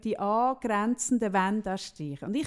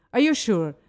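mock suspicious question; 8 dB louder than usual. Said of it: "sie so".

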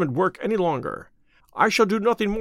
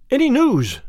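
eager and hopeful, lifted high and louder; 7 semitones higher, 4 dB louder than usual. A narrator's reading. Invalid asks the detective, eager question. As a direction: jump in with the question, keen to know. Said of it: "he asked, eagerly".

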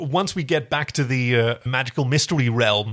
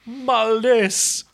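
higher, drawn-out Dublin-Kingston blend; blended accent; 8 semitones higher than usual. Shown as a demonstration. Host trying the villain's name in a blended Dublin-Kingston accent. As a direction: attempt a mixed Irish-Jamaican accent on the name. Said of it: "trying to do the blended accent".

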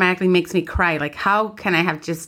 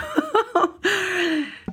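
high-pitched giggle, real amusement; high laugh; 13 semitones higher than usual. Real, not acted. Laughing at her own story of crying, real giggle. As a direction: burst into a high, trailing laugh.

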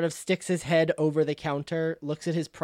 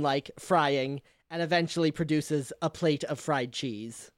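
ordinary delivery

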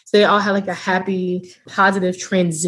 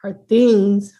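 ordinary talk, nothing marked

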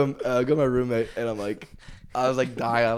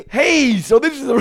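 loud, high, drawn-out awkward greeting; Will Ferrell; 14 semitones higher, 12 dB louder than usual. Retold or imitated. Host showing recruit and mom the room, loud forced cheer. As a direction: cover the awkwardness with an over-loud welcome. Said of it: "Like Will Ferrell".

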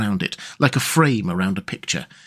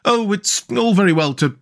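higher, peevish Mole character voice; pettish; 7 semitones higher than usual. A narrator's reading. Mole answering Rat's invitation, rather pettish. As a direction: grumble it, a little put out. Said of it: "said the Mole rather pettishly".

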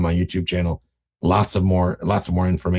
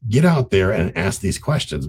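ordinary talk

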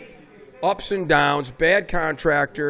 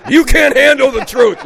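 higher, louder hammed-up intense officer; movie line impression; 6 semitones higher, 7 dB louder than usual. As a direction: belt it like a dramatic courtroom showdown.

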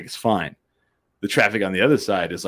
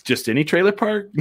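higher, disbelieving rise; incredulous question; 9 semitones higher than usual. Retold or imitated. Host asks his friend about the trailer park, incredulous. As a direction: ask it in disbelief. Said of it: "And I was like".

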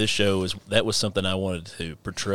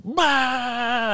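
mock kid wail. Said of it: "And I'm like".

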